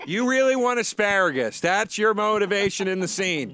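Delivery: high, loud coaching voice; a hammed-up acting coach; improv teacher.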